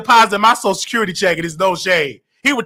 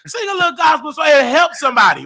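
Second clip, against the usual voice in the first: high excited coaxing; 9 semitones higher than usual. Real, not acted. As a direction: coax her, voice pitched up. Host coaxing caller to sing.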